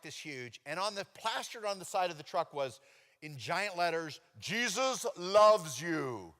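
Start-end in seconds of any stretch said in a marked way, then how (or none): 4.4-6.3 very slow, loud, every word drawn out; booming slow announcement; slow proclamation. Acted. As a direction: read it like a giant billboard. Reading giant truck letters.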